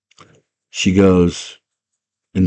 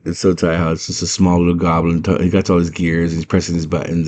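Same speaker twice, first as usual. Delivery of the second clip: ordinary talk, nothing marked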